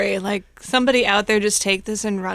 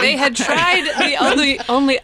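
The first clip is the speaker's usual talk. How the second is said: laughing through the joke, louder and higher; 4 semitones higher, 5 dB louder than usual. Real, not acted. Joking with friends about nuns who fled, laughing. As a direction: chuckle out the punchline as you say it.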